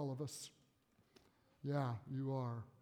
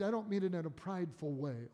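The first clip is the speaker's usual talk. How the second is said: ordinary talk, nothing marked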